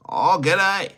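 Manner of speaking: a hammed-up Australian hello; Australian accent. Australian accent, dreaming of skating for Australia. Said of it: "skated for Australia".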